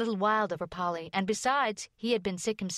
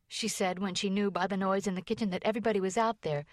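ordinary delivery, nothing marked

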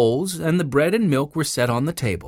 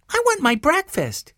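put-on high-pitched child's demand; high little-boy voice; little boy Benny. A narrator's reading. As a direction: insist like a hungry small boy.

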